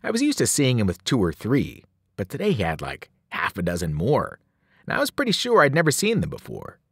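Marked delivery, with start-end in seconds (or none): none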